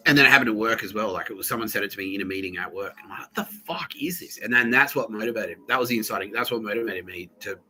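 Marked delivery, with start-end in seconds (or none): 3.2-4.4 slow, whispered incredulity; retold under-the-breath reaction; hushed disbelief. Teller replaying own reaction to the work meeting, hushed disbelief.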